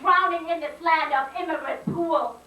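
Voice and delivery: high, insistent repeated declarations; impassioned slam poem. Acted. Poet declares anger for parents' sacrifice, impassioned spoken-word litany. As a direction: build a rhythmic litany of anger.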